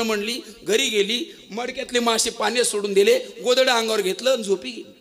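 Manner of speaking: normal talking voice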